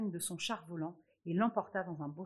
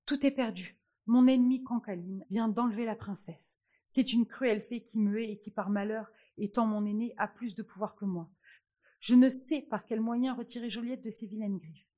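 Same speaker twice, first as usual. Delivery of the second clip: frightened; 3 semitones higher, 8 dB louder than usual. A narrator's reading. Fairy tells the queen the princess is gone, loud and frightened. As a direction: blurt it out in fright, louder and louder. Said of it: "Remontant fort effrayée".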